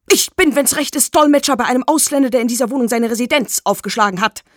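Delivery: loud, high, rapid declaration; brash announcement. Acted. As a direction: rattle off the introduction at full volume. Brash stranger loudly introduces himself. (laut und schnell verkündend)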